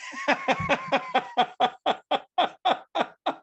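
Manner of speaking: cracking up high and hard. Real, not acted. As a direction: burst out laughing at himself. Cracking up at own joke.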